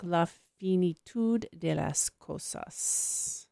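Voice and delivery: slow, careful attempt at Spanish; Spanish accent. Real, not acted. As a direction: sound out La Finitud de las Cosas in Spanish. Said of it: "Apologies for my horrible Spanish accent".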